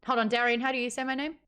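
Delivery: ordinary talk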